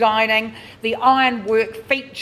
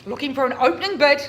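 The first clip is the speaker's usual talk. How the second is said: brisk, raised auction call; auctioneer call; 8 semitones higher, 4 dB louder, faster than usual. Real, not acted. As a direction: call out for the opening bid. Auctioneer calling for bids.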